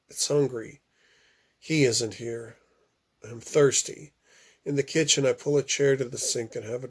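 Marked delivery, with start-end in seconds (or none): none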